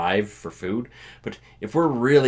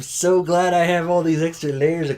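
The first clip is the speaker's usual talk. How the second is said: high, loud, hammed-up relief; exaggerated thankful whine; mock gratitude; 8 semitones higher, 5 dB louder than usual. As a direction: gush over being bundled up. Imitates self glad of layers.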